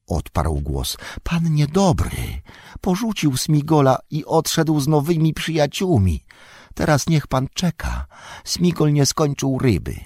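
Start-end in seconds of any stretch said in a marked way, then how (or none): 1.2-10.0 high, whining creature voice; Golum, sulky. Acted. Golum reproaching his master for leaving him, high sulky whine.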